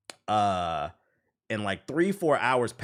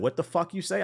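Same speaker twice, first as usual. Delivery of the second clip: retold confrontation; 7 semitones higher than usual. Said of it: "why are you talking like that".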